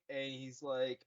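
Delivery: normal talking voice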